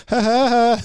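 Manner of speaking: high, imitated nervous laughter. Mortified audience at the club, imitated nervous laugh. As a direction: fake an uneasy laugh. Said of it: "like, nervously like".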